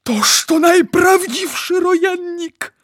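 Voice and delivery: high, loud, drawn-out wonder; thrilled awe. A narrator's reading. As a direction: marvel slowly with intense excitement. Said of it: "szepnął z przejęciem".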